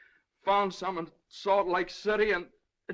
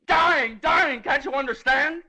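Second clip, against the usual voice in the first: high, loud anguished cry; desperate outburst; 6 semitones higher, 5 dB louder, slower than usual. Acted. Father to judge about his dying baby, desperate outburst. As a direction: cry it out to make him grasp it.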